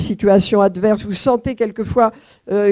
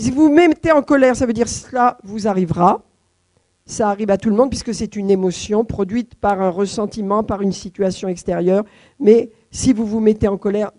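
ordinary talk

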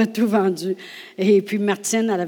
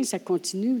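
ordinary talk

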